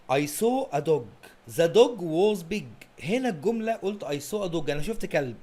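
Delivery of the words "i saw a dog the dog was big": very slow, word by word, louder on the dog; slow dictation. Shown as a demonstration. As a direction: read it out slowly for the class. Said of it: "نبص على الجملة دي". (قراءة بطيئة جدًا كلمة كلمة للطلبة)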